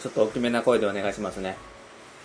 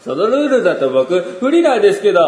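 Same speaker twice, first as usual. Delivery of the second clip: higher and louder whiny protest; friend's complaint; 7 semitones higher, 8 dB louder than usual. Retold or imitated. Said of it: "最後のは友人のセリフなんですけど".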